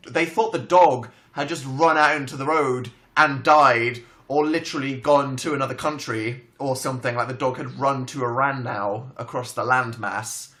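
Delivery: ordinary talk, nothing marked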